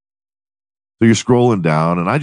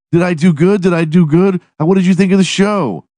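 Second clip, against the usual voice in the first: put-on high, bright little girl's voice, much higher and louder; excited little girl; 12 semitones higher, 4 dB louder than usual. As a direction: play a six-year-old begging for praise. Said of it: "little girl continues".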